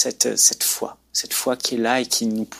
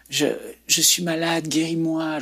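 higher, restrained cry for healing; imitated plea of the sick; 5 semitones higher than usual. Sick people crying to God in a vision, restrained imitated plea. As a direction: plead upward without shouting, as a retold cry. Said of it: "ils criaient".

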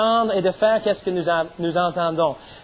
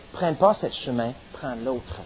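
voix de l'Esprit, basse et douce; petite voix tranquille; 6 semitones lower, 6 dB quieter than usual. Acted. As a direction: murmure doux et plus bas. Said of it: "la petite voix tranquille". (l'Esprit qui guide, voix douce et basse)

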